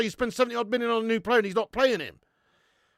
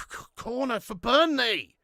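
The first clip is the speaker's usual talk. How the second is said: normal talking voice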